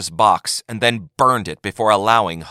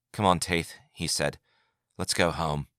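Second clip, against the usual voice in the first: softer, tired coaxing; weary; 3 semitones lower, 6 dB quieter than usual. A narrator's reading. Yagar quietly coaxes Taith to come home. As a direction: gently lead her out.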